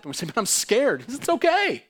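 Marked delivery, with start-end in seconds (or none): 0.3-1.0 high, louder frightened objection; mock-fearful cry, pitched up; scared protest. Voicing fearful believers to God. 1.1-1.9 very high, loud reassuring cry; impassioned, high-pitched comfort; emphatic reassurance. Acted. God answering the fearful.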